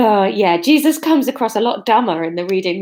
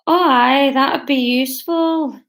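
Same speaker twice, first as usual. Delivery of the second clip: put-on higher, drawn-out Scottish accent; amateur Scottish accent, slow and higher; shaky Scottish accent; 4 semitones higher, slower than usual. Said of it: "distinguishable from the Scottish accent".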